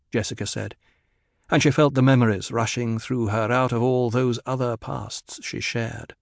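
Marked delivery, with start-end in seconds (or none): none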